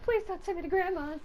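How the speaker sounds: put-on high pleading kid voice; pleading child. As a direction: beg like a scared child. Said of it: "they're like".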